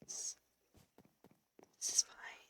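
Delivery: unvoiced resigned whisper of is fine. Real, not acted. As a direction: breathe is fine with no voice. Muttering after dying.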